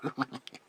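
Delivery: short, high, loud giggle; creepy giggle. Acted. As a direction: burst into an eerie high titter. Amused at living forever.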